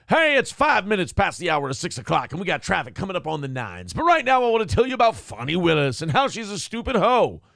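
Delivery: mock morning traffic-report announcer; hammed-up radio patter, swelling toward the end. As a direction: punch it out like a parody radio host. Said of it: "say this like a radio announcer".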